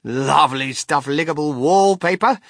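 loud, delighted exclamation; crying out. A narrator's reading. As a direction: sing its praises in passing. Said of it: "cried Mister Wonka".

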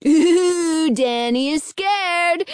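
high, drawn-out teasing; taunting cry. A narrator's reading. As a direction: tease like a schoolyard taunt. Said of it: "Fred cried".

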